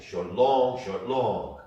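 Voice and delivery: teacher showing accents in the rhythm; accented rhythm. Shown as a demonstration. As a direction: punch each long with an accent. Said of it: "And now put the accent".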